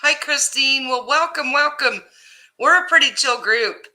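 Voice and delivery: usual speaking voice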